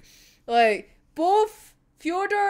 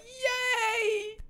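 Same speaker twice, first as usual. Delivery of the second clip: small high, soft yay; hushed mock-happy squeal; gleeful; 10 semitones higher, 7 dB quieter than usual. Nikolai thrilled by Fyodor's attention, gleeful. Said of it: "Nikolai's just so happy".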